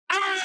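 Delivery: high, loud cry of alarm; hammed-up yell of terror; staged scream. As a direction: scream as if being attacked. Staged attack off camera.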